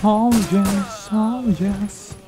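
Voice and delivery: mock English cheer.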